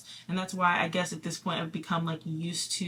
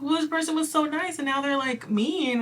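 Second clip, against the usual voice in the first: raised-pitch mock dismay; high, puzzled self-quote; 8 semitones higher, 3 dB louder than usual. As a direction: pitch the voice up, puzzled and a little hurt.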